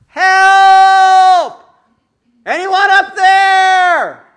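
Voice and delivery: high, loud cry for help, "up there" stretched long; desperate yell. Retold or imitated. Man hanging off a cliff, shouting up high and loud for help. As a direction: yell up the cliff at the top of your lungs. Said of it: "So he yelled out".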